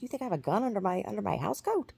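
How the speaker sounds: mother's high-pitched disbelief, retold; acted-out affronted challenge; indignant retort. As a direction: fire back a fast, incredulous question.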